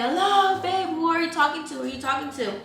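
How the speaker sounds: high call, easing off by the end; re-enacted holler down the hall; retold screaming. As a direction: call out insistently for an answer. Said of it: "I was literally screaming from across the hall".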